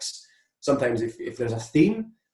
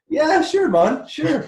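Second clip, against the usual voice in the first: much higher and louder, bluffing breezy eagerness; fake-confident yes; 6 dB louder, much slower than usual. Answering the organiser's country question, mock-eager bluff. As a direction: oversell it like a nervous bluff. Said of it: "I was like".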